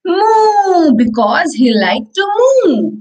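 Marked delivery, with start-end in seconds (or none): 0.0-0.9 high bright moo, pitched up; mooing rabbit. Acted. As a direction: moo like a little rabbit pretending to be a cow. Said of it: "Rabbit is saying".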